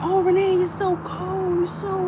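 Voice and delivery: slow whiny mocking voice; mock critics. Mocking people who call her cold, slow whiny voice. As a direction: imitate critics, drawn-out and whiny. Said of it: "People like".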